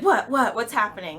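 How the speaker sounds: high, loud mock jolt awake; startled awake. Daughter mimicking mom waking up fast, startled. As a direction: imitate someone snapping out of dead sleep. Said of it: "wake up super fast".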